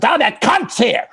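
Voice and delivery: high shouted dummy voice, cheerfully crude; puppet voice. Retold or imitated. Ventriloquist retelling a puppet greeting Claudia, high shout. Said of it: "And he goes".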